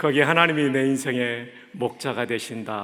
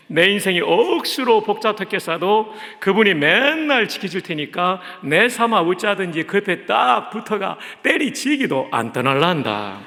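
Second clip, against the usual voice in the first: louder, hearty Gyeongsang dialect reading; 5 semitones higher, 6 dB louder than usual. Shown as a demonstration. Pastor reading Psalm 23 to the congregation in louder Gyeongsang dialect. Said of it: "먼저 경상도 버전으로 읽어드리겠습니다".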